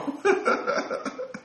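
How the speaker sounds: high breathless chuckle; amused laugh. Real, not acted. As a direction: chuckle at your own punchline. Laughs after the quoted joke.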